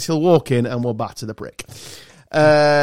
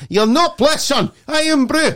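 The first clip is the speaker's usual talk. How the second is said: hammed-up Scottish coach; 11 semitones higher, slower than usual. Host parodies the Scottish coach for his mates, hammed-up Scottish accent. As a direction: ham up the Scottish manager. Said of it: "quoting the manager clip".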